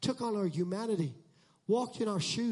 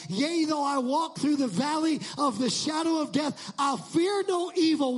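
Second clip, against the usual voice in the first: louder, higher psalm quote building up; rising scripture recital; 6 semitones higher, 5 dB louder than usual. Real, not acted. Preacher to congregation, proclaiming loudly. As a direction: proclaim the psalm with mounting force.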